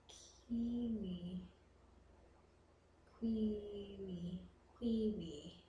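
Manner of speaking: very soft, drawn-out word; hushed sounding-out. Shown as a demonstration. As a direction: say it softly, stretching each vowel.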